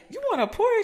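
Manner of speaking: very high, incredulous "You want a Porsche?"; high-pitched imitation of a surprised friend; mock surprised friend. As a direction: squeak it out like she can't believe it. Friend's reply retold in quote.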